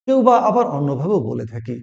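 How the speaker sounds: normal talking voice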